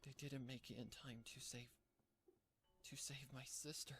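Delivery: soft, halting regret; sorrowful recollection. A narrator's reading. Filly remembers her sister's death, quiet sorrow. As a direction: recall it quietly, breaking off midway. Character recalls failing to save sister.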